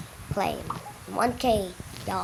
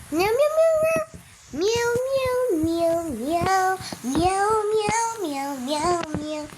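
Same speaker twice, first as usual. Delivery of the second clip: high, loud anxious meowing; nervous cat voice; 8 semitones higher, 3 dB louder, slower than usual. Pretend. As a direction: meow like a scared kitten. Meowing while water rises.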